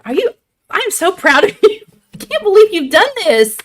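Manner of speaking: high, excited squeal of pride; proud gushing. Real, not acted. Host praising her friend for what she's done, gushing high with pride.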